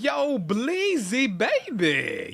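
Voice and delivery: long, stretched, sing-song yo Bleezy; drawn-out greeting. Real, not acted. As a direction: call the name out slowly and high. Greeting a returning viewer.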